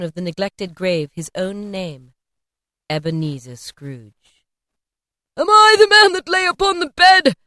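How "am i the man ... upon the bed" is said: much higher, loud terrified cry; anguished cry. A narrator's reading. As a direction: cry out on your knees in horror. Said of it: "he cried upon his knees".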